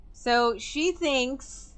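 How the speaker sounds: normal talking voice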